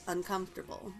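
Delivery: usual speaking voice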